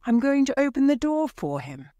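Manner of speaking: high and fast, breathless eagerness; rushing out the words in hope; eager rush. A narrator's reading. Wife tells husband she'll open door, high and fast.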